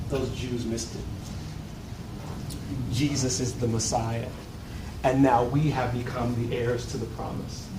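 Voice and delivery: low, soft, smug voice of self-satisfied believers. Retold or imitated. Preacher imitating church members who feel superior, low smug voice.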